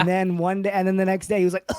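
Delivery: ordinary delivery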